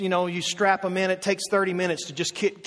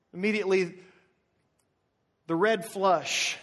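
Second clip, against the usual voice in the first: ordinary talk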